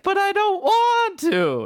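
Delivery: put-on high, slow whiny girl's voice; reluctant daughter. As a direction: plead like an unwilling daughter. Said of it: "And then the daughter was like".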